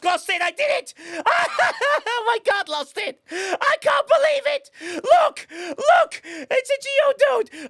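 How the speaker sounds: high-pitched, loud, yelling with laughter in pure disbelief; breathless, screaming joy at a long-awaited find; ecstatic shouting. Real, not acted. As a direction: shriek the win like you can't believe your luck. Finds rare Geodude live on stream.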